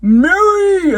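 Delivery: high, loud calling out of a wife's name; impression of John. Recapping the pilot, imitating John shouting for his wife. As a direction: yell for her upstairs as the panicked husband. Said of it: "That's my best impression".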